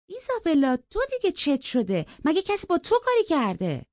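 put-on high, impatient girl's question; fed up with the crybaby; exasperated girl. Cathy impatiently asks crying Isabella what is wrong.

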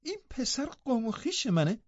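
scornful chuckle, then high, soft disbelief; sneering, incredulous girl; mocking laugh. A narrator's reading. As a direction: laugh derisively and ask in a high voice. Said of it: "کاتی با خندهٔ تمسخرآمیزی گفت".

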